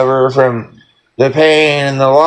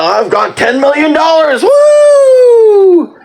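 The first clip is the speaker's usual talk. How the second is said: jubilant whoop; 11 semitones higher, 4 dB louder, slower than usual. Retold or imitated. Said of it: "you know".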